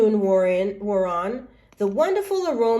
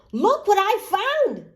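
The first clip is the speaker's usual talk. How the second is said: excited hen; 11 semitones higher, 4 dB louder than usual. Acted. Hen shows her find.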